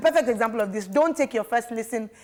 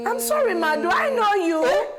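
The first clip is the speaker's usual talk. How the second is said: high, slow mock-innocent denial; high slow feigned innocence; fake polite denial; 8 semitones higher than usual. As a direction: pretend not to know your own mom, pitched up and drawn out. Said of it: "And you're like".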